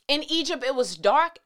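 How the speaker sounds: normal talking voice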